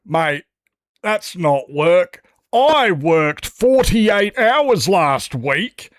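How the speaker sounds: high, slow, loud mock of a workmate trumping you; mocking bragging mate. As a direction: drag it out like a smug one-upper showing off his hours. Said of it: "when you have people saying".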